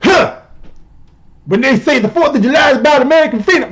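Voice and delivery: high-pitched sassy caricature; exaggerated sassy tweet read-out; mock Cori Bush. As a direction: read it as an indignant, high-voiced mockery. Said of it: "Cori Bush tweeted out, and I quote".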